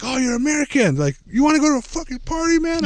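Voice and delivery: hammed-up invitation from a tipsy teen; imitating drunk kids offering a party; mock rowdy kids.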